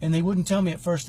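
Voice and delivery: normal talking voice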